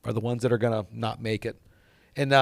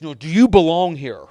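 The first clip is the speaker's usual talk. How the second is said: loud, higher stern challenge; super serious; 4 semitones higher, 10 dB louder than usual. Retold or imitated. Mentor confronting a young golfer, super serious. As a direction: demand it forcefully, imitating an intense mentor. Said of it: "super serious face".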